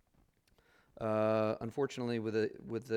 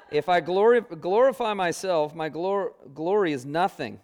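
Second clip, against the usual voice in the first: reading Scripture aloud, higher and much louder, through real laughter; laughing while reading; 6 semitones higher, 9 dB louder than usual. Real, not acted. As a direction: stumble through the words as the laugh breaks in. Reading through his own laughter.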